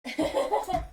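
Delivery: soft high laugh; embarrassed laugh. Real, not acted. Streamer giggling after knocking things off her desk. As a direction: giggle at her own clumsiness.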